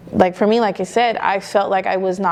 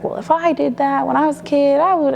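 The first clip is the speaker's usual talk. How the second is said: higher-pitched imitation of an old-school father; old-school dad; 7 semitones higher than usual. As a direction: quote your dad in a raised pitch. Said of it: "he's old school".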